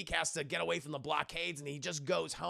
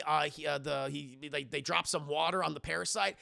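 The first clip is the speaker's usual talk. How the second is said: plain reading voice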